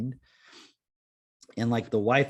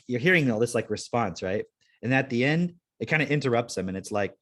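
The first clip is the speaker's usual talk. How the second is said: ordinary delivery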